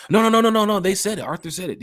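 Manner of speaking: high, loud fan insisting no; mock fan denial. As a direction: ham up a stubborn fan. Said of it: "Fans used to be like".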